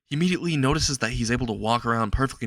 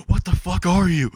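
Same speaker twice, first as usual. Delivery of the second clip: high, loud terrified scream; 8 semitones higher, 7 dB louder than usual. A narrator's reading. Boy sees creature in mirror, screams in loud terror. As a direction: shriek it out at the creature. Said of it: "starts to scream".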